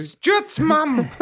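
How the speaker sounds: mum voice. Pretend. Voicing Stuart's mum.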